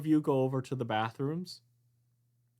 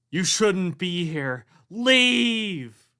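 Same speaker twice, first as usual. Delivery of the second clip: echoey ghost voice; 8 semitones higher, 10 dB louder, much slower than usual. Acted. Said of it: "a little bit echoey that says".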